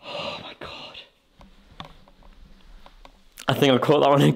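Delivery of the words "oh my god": soft unvoiced whisper. Real, not acted. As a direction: breathe it in fear. Whisper in dark.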